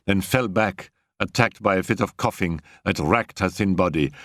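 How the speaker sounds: usual speaking voice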